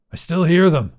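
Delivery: half-shouted alarm in a story; shouting. Acted. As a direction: call it out, a restrained shout. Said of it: "Grizz was shouting".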